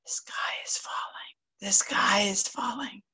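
sly breathy whisper on the wind. Acted. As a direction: whisper it like a rumour carried on the breeze. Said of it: "Fox whispered on the breeze".

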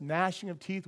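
normal talking voice

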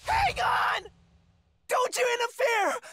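high frantic yell; shrill panicked protest; desperate shout. Acted. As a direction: stop them at all costs, shouting. Desperate to stop the girl.

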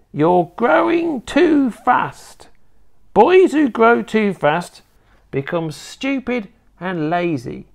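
high, slow grandma voice; scolding old grandma. Acted. Grandma telling off her growing grandson, scolding. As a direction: lecture him in a high, drawn-out old woman's voice.

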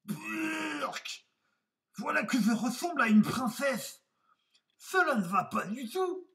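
high, loud cry of disgust; put-on high wolf voice, disgusted; exclaiming wolf. A narrator's reading. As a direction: recoil from the mirror in high-pitched outrage. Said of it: "et s'exclama".

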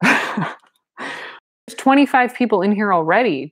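slow, high, aghast protest. Acted. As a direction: protest in open dismay. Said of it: "Jane protested aghast".

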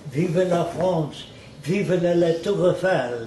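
hammed-up French patriot, drawn out; lightly imitated French cheer, slow; French accent. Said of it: "Then I noticed in France".

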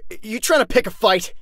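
stammered, high-pitched angry comeback; indignant challenge. Acted. Fighter insulted about wolves, indignant outburst. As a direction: stumble in, then raise your voice.

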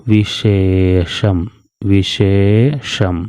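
each syllable stretched slowly; slow demo reading. Shown as a demonstration. Teacher models the word slowly for pupils. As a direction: read it twice, very slowly. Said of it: "ഇനി അടുത്തത്".

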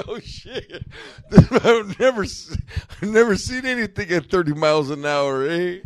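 high voice, laughing through it; mock the friends marveling, cracking up; laughing amazement. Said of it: "They're like".

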